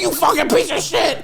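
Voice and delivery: high mock rage. Re-enacting cursing the printer, high mock fury. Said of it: "he heard me go".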